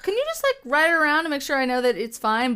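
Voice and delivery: high, sheepish asking voice that settles; pleading request. Retold or imitated. Asking the seller to ride the scooter for her, sheepish high plea. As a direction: ask nervously, like begging a favour.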